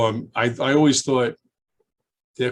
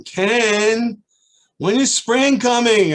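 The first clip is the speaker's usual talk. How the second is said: put-on high, loud, drawn-out child's plea; high child voice whining for spring; eager child; 11 semitones higher, 7 dB louder, much slower than usual. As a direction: ask like an impatient four-year-old. Kids voiced asking teacher.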